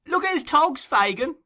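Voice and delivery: high, loud cheeky youngster; excited boy. Acted. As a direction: crow it like a delighted street boy. Charlie's voice, excited.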